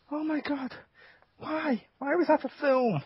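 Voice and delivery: ordinary talk, nothing marked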